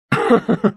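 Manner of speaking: short villainous chuckle; gloating laugh. Acted. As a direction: chuckle to yourself as the scheme falls into place. Villain gloats over the death.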